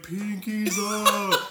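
high, drawn-out cartoon call; Patrick. Patrick calling to SpongeBob through the glass, high drawn-out cartoon voice. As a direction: stretch it out in a put-on cartoon voice. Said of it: "So then Patrick's on the other side".

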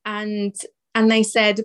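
normal talking voice

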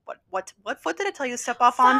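stern dance teacher. Pretend. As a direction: snap at the student like a strict teacher. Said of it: "dance teacher".

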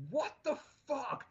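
high-pitched, drawn-out outraged yell; retold shout. Employee shouting after the man who double parked, high-pitched outraged shout. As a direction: shout it high, in indignant disbelief. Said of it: "And I shouted out to him".

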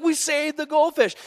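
high, celebratory crowd voice; imitated cheering. Crowd celebrating the saved goldfish, mock cheering. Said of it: "everyone clapped and cheered".

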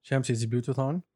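ordinary delivery

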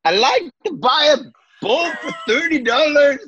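high-pitched hammed-up Australian accent. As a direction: play a squeaky-high comic Aussie. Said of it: "oh, Australian".

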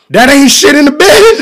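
high, loud pleading ask; hopeful high question. Retold or imitated. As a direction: squeak it out hopefully. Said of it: "I said".

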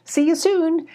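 high bright kitten farewell; cheerful kitten goodbye. Acted.

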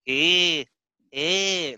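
put-on drawn-out "eh" as a Mexican accent; lightly imitated Mexican woman's "eh"; borrowed comedy bit of a Mexican accent; mock Mexican woman. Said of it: "Mexican women sound like".

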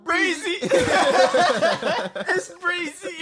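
high, wailed nickname; mock crying. Joking about meeting [name], mock tearful squeal. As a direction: cry out the name like an overcome fan.

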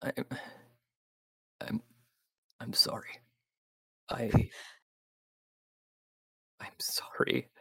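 choked, barely voiced I'm sorry; a hushed apology from a sheriff close to tears; whispered apology. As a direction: breathe the apology out, near tears.

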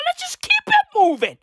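brushing off the president's update; mock-breezy sing-song. Real, not acted. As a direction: toss it off in a high voice. Mock cheerful shrug.

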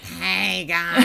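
loud, raspy smoker's impression; Marge Simpson's sisters. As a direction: rasp it out like a heavy smoker. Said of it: "sound like one of Marge Simpson's sisters".

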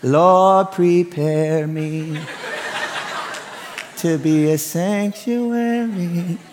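slow, drawn-out plea for patience, half laughing; mock prayer. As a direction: pray it slowly like a tested father.

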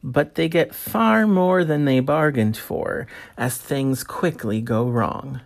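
podcast intro read. Acted. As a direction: read it like a show opener.

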